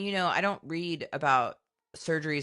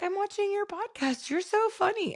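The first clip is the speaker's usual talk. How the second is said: very high-pitched imitation of mom; Mary Catherine impression. Imitating mom tickled by her daughter's podcast, high-pitched delight. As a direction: put on a mother's high, delighted voice. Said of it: "She's like".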